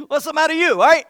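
high, loud, joking reproach; playful mock scolding. Preacher ribbing the Italians in the room, playful loud scolding. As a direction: scold them loudly in jest.

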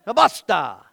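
loud, emphatic "Basta"; forceful demo. Shown as a demonstration. Preacher rallying the Italians to say it, loud and emphatic. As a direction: belt out "Basta" like an exclamation. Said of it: "you gotta do it like this".

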